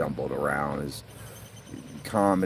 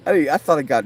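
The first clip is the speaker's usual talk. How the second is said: laughing; 10 semitones higher, 8 dB louder than usual. Real, not acted. Laughing retelling.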